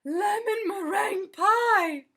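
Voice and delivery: high, loud, slow cry of delight; delighted exclamation. Acted. Missus Rogers tasting the pie, loud slow joyful cry. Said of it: "she exclaimed".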